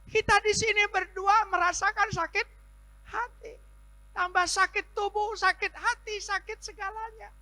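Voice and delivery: high, loud pleading sermon; impassioned preaching. Acted. Pastor pressing the sick woman, high and loud. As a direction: drive the point home to her.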